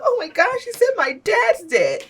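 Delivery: high, gasping mock shock, slowing on the last words; hammed-up pity, high and drawn out; mock dramatic gasp. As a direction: overplay the shocked tweet. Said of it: "not in a sense of".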